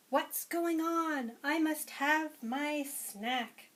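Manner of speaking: drawn-out, demanding duck. Acted.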